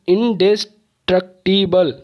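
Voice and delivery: इंडेस्ट्रक्टिबल split into five separate beats; syllable by syllable. Shown as a demonstration. Teacher showing five syllables, इंडेस्ट्रक्टिबल broken up. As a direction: break इंडेस्ट्रक्टिबल apart at every syllable. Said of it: "तोड़ तोड़ के आवाज़".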